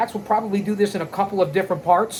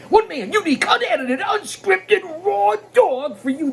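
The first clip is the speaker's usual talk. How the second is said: high-pitched wrestling promo burst; excited, high-pitched show slogan; hype tagline; 12 semitones higher than usual. Acted. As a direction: belt it out like a ringside hype man.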